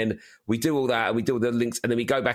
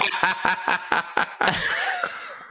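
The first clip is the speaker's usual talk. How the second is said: burst of laughter; 8 semitones higher than usual. Real, not acted. Laughing at host remark.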